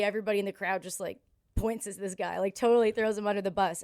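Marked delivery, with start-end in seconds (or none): none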